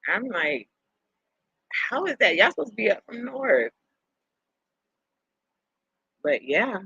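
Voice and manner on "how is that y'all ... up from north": baffled. Retold or imitated. Recounts her puzzled reaction to northerners.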